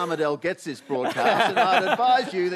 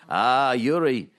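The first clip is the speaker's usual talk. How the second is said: slow, louder mock Russian friend; imitating a friend; 6 semitones lower, 4 dB louder, much slower than usual. Farmer's friends greeting Yuri, slow hammed-up call. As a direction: drag out the greeting like a jolly friend. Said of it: "his friends will all say".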